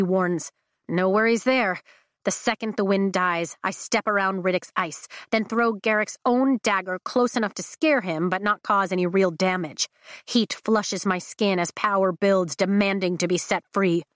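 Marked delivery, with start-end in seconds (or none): none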